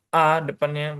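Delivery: ordinary delivery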